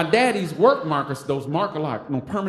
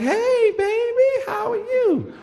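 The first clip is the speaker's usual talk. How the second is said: much higher, sing-song auntie voice; sweet auntie greeting; much slower than usual. Retold or imitated. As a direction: coo it like a doting auntie. Aunties greeting him upstairs.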